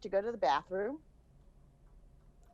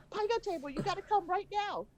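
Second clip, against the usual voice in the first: very high, rushed and urgent; urgent excitement. Retold or imitated. Mom urging son to come to the table, excited. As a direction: beg him to hurry over, thrilled.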